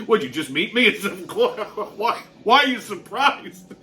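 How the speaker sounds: laughing while quoting himself; retold through laughter. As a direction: barely get the retort out for laughing. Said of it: "doubled over laughing".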